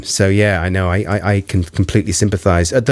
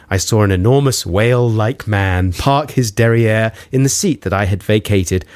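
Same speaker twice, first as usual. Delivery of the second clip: ordinary talk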